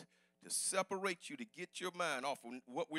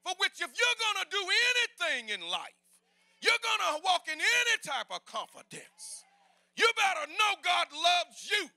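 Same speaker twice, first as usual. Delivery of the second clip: full-force preaching; 11 semitones higher, 7 dB louder than usual. Acted. Pastor urges congregation to know God loves them, preaching at full shout. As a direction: preach it hard to the back pew.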